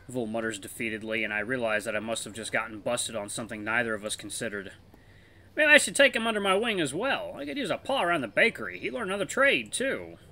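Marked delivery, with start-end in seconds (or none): 5.6-10.2 put-on higher, genial older wolf voice; friendly older wolf. Elder wolf offering to take the pup as apprentice, genial and higher. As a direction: play the kindly elder wolf teasing his friend. Voices Vithir in visual novel.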